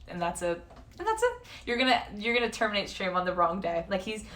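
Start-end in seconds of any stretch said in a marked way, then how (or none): 1.0-1.3 squeaky repeat. Shown as a demonstration. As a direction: jump much higher on a mock squeak. Repeats phrase for effect.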